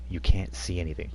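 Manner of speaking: ordinary talk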